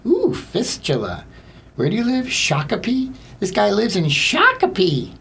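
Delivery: high, gleeful mock delight; squealy put-on excitement; relishing funny words.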